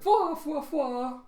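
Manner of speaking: very high, loud "fwa" hoots; cartoon laughter. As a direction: laugh helplessly in falsetto fwas. Killer's helpless laugh continues.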